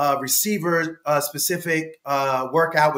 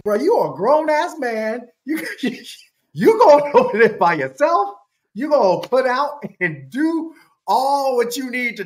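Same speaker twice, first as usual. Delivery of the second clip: higher, louder playful ribbing with laughs; teasing; 7 semitones higher, 5 dB louder than usual. Retold or imitated. Father ribbing his son to go alone, louder playful teasing. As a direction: rib him like a buddy.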